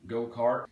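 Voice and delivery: "Go-kart" with rounded R; strong American R in "Go-kart"; Texan pronunciation. Shown as a demonstration. Teacher demonstrating American, "Go-kart" with rounded R. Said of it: "Again a rounded R".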